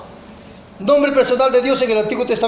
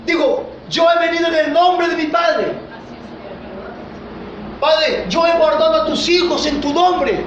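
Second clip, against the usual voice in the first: raised, emphatic sermon voice; fervent preaching; 5 semitones higher than usual. Acted. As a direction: preach it out with full conviction. Preacher exhorting the congregation. (predicación ferviente en voz alta)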